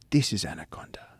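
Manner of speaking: whispered under the breath, hushed; mock hushed whisper so nothing hears; retold whisper. Friend hushing on the raft at night, whispered.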